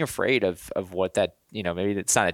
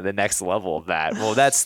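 ordinary delivery

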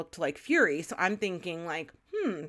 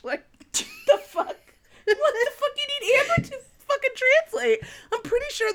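laughing; 14 semitones higher, 4 dB louder than usual. Real, not acted. Ranting through laughter about Rhys.

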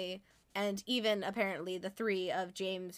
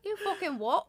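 a purposefully bad British accent, pitched up; 8 semitones higher than usual. Friend mocking British slang in a hammed-up bad British accent. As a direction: ham up a fake British accent. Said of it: "horrendous British accents".

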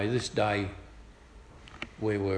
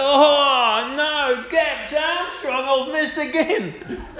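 high, loud, slow drawn-out lament; mock wail; 14 semitones higher, 5 dB louder, much slower than usual. Worker crying out his mate's full name after missing, high slow mock wail. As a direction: stretch the full name out like a curse.

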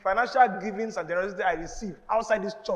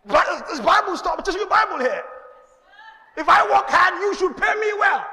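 high, loud, fiery preaching; preaching at full force; 8 semitones higher, 7 dB louder than usual. Acted. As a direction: drive it home to the congregation, shouting.

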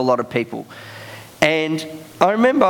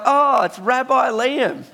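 higher, drawn-out mock greeting; lightly mimicked sing-out, pitched up and stretched; elevator greeting; 8 semitones higher, slower than usual. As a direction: imitate people slowly hailing a known character.

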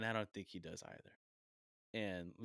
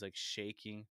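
usual speaking voice

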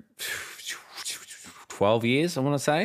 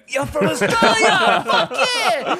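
very high, loud whooping; hammed-up American thrill, laughing; over-the-top excited; 7 dB louder than usual. Imitating Americans thrilled to meet an Australian, ecstatic shouting.

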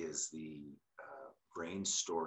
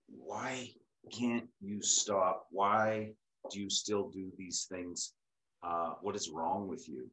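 slow, louder imitation of exasperated questioning; exasperated pleading; 3 semitones higher, 5 dB louder, slower than usual. Imitating onlookers questioning someone in active addiction, exasperated. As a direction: stretch each question out in mock frustration.

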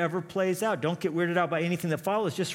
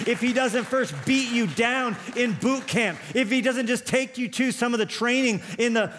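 driving, higher-pitched sermon delivery; impassioned preaching; 6 semitones higher, 3 dB louder than usual. Real, not acted. Preacher urging the congregation through God's training, impassioned preaching. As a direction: preach it with urgency, phrase after phrase.

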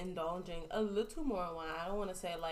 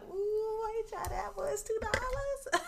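much higher, delighted voice; high excited voice; 13 semitones higher than usual. Retold or imitated. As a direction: squeal at the bargain. Voicing herself in wine aisle.

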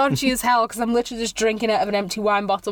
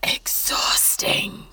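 whisper; 4 dB quieter, much slower than usual. Real, not acted.

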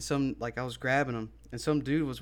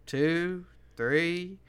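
slow, higher counting imitation; retold dog owner; 4 semitones higher, 4 dB louder, slower than usual. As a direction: drag out the count like the boasting owner. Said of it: "She's like".

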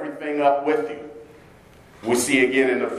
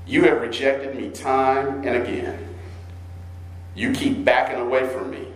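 solemn scripture reading; 5 semitones lower than usual. Acted. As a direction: let God's weariness weigh on it. Reads Jeremiah in God's voice.

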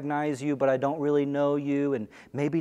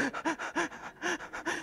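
sharp high airy gasp of alarm; panicked gasp; 14 semitones higher, 11 dB quieter than usual. Retold or imitated. Person realizing their phone is lost, a panicked gasp. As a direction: suck in air like your phone is gone. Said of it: "you start to panic".

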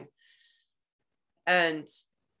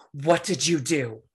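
livid; 2 semitones lower than usual. Retold or imitated. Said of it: "just livid".

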